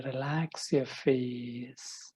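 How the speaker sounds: slow, calm relaxing voice; slow soothing cue. Acted. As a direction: draw it out gently. Guided practice cue. (медленно и успокаивающе)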